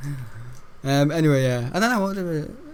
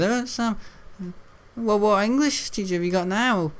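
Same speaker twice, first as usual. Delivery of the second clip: high imitation of the librarian asking; playing the librarian again; mock librarian voice; librarian's voice; 6 semitones higher than usual.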